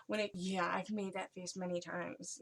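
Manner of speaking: ordinary delivery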